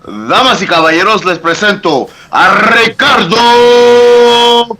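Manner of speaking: Spanish ring announcer, name stretched out; lucha libre announcer. Pretend. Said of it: "Show him how it's done".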